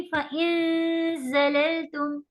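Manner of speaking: फ़इन ज़ललतुम slow, noon hidden and buzzed between teeth; slow tajweed chant; ikhfa recitation. Shown as a demonstration. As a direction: draw the noon out slowly before ज़. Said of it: "दांत पे दांत लेके नून को खींचेंगी".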